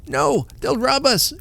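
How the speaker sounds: put-on high, slow alarmed cry of an old man; fearful shriek. A narrator's reading. Old husband fearing robbers, shrieking. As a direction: shriek it in panic. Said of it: "shrieked the old man".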